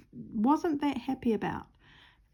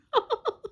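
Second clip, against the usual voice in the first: high burst of real laughter; bright squeal of a laugh; 4 dB louder than usual. Real, not acted. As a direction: let a high laugh escape. Laugh alone.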